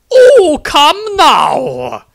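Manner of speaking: an adoring nun cooing at the wizard she loves; gushing besotted nun. Acted. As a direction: swoon over him like a lovesick nun. Nun coaxes.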